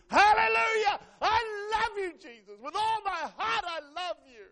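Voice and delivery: very high, loud ecstatic cry; uninhibited praise shout. Acted. Preacher acting out worship once the Holy Ghost hits, shouted. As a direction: belt out praise with long, held words. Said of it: "when the Holy Ghost hits you, it's no longer".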